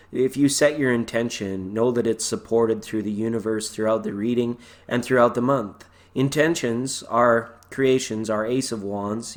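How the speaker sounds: normal talking voice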